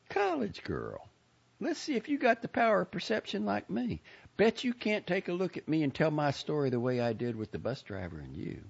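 put-on high, gushing old lady voice; a proud, fussy Southern lady; chatty old lady. A narrator's reading. Lady character voice.

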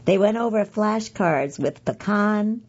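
ordinary talk

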